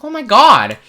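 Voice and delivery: high, loud groan of dismay; hearing teachers will be found, high and loud; dismayed oh my God. Retold or imitated. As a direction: react like you're doomed. Dread at teacher's threat.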